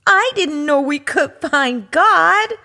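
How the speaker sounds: put-on a delighted child; excited high cub voice; thrilled little cub.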